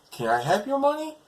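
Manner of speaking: very high, rising question; naive request. Retold or imitated. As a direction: ask like a naive innocent, voice shooting up. Naive hero asks rescued woman.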